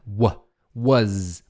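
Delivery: "Wa" then "was", adding the buzzing z; "was" ending in z, not s; pronunciation built up. Shown as a demonstration. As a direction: build "was" from "wa" to "waz". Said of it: "Vou até botar W".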